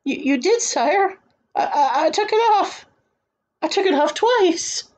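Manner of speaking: very high, stammering voice; stammer, then squeak out the defence; flustered protest. A narrator's reading. Flustered boy protests innocence to king.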